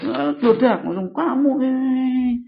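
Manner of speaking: mock scolding in a high-pitched voice; imitated parental scolding. Parent scolds child who slipped. (menirukan orang tua mengomel, nada tinggi)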